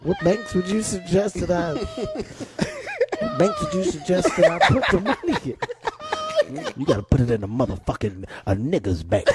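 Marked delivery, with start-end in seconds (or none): 1.7-6.7 high, slow, breaking into laughter; can't hold it together asking; laughing through it. Real, not acted. Comedian cracking up while asking which banks, laughing. 6.8-9.3 much lower, gruff and fast, laughing through it; Dick Gregory impression. Comedian quoting the elder's bank advice, low and gruff.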